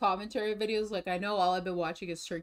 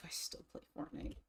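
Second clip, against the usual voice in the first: whispered admission; 7 semitones higher, 14 dB quieter than usual. Real, not acted. Sheepish admission.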